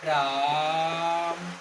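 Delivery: राम with the आ stretched very long; prolonged calling. Shown as a demonstration. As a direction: call out राम, holding the vowel. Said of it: "क्या बोलते हैं बच्चों".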